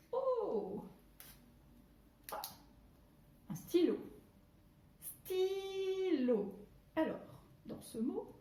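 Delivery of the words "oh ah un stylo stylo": high "Oh!" of pretend discovery; mock surprise. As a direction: act amazed to find a pen. Discovers pen. (surprise jouée, aiguë)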